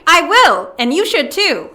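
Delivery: defiant shout. Acted. To her sister, a defiant loud retort. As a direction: dig in your heels and shout it.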